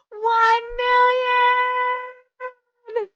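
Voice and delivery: high, very slow drawn-out disbelief; amazed. Real, not acted. Streamer watching the tap count hit a million, stunned drawn-out cry. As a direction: stretch it out in stunned joy.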